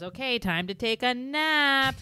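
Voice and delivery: high, loud, slow singsong; Wayne impression. Imitating dad forgetting the forbidden word, hammed-up singsong. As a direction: build to a loud, stretched last word.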